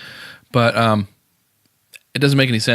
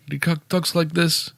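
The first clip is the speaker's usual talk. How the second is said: bad Brooklyn accent; 7 semitones higher than usual. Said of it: "a Brooklyn accent, which is terrible".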